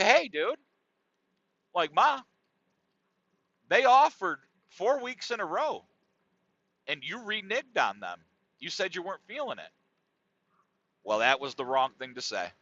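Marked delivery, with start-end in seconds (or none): 0.0-9.7 high, pleading protest to his mom; exasperated protest. Retold or imitated. Son protesting to his mother, high and exasperated. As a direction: voice climbs in disbelief.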